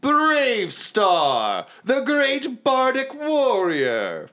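high, slow grandiose self-introduction; vain bard. Acted. Vain bard introduces himself to Werner, grandiose and slow. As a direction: announce yourself like a legend. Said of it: "he's like".

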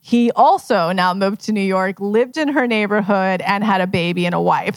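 ordinary talk

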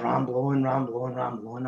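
fading. Retold or imitated. Bored repetition fading away.

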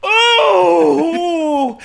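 high, loud, breathless mock moaning; mock sexy screaming. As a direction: scream it like a hammy porn star. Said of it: "I literally am screaming".

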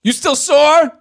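high, loud, drawn-out questions; mock boyfriend texter. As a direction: ham up the crude texter. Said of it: "I'll be him".

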